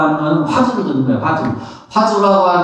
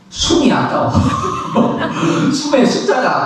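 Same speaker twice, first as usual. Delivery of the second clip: breaks into a breathy laugh at the greed; 10 semitones higher than usual. Real, not acted. Monk laughing at breath-counting greed, amused chuckle.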